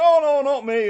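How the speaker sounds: high, loud protesting denial; acted protest of innocence; emphatic denial. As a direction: deny it loudly, pitch jumping up. Repeats denial when accused.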